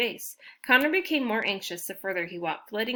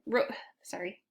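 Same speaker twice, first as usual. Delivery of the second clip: usual speaking voice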